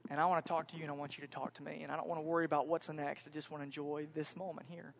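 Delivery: soft, low fatherly reassurance; tender father. Retold or imitated. Dad gently asks son to sit.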